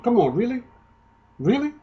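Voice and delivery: sarcastic come on really; incredulous. Real, not acted. Mocking disbelief at the claim.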